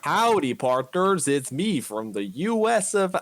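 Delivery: high, slow hammed-up American cowboy voice; terrible American accent. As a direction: ham up a bad American accent. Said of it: "doing a terrible American accent".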